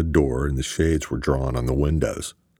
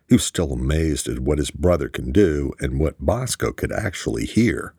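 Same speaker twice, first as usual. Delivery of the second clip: ordinary delivery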